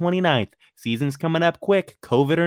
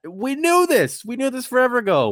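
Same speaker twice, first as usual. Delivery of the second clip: exasperated; 9 semitones higher, 3 dB louder than usual. Real, not acted. Ranting about injury news.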